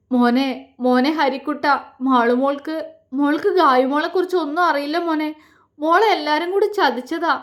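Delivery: understated acted sobbing, pitch climbing; tearful. A narrator's reading. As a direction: plead through held-back tears, voice changed. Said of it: "തേങ്ങിക്കൊണ്ട് പറഞ്ഞു". (തേങ്ങലോടെ പറയുന്നു)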